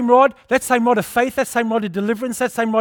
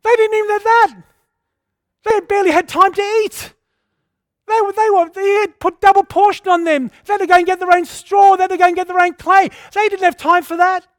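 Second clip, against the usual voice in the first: mocking retort; 10 semitones higher, 5 dB louder than usual. Preacher mocking the complainers.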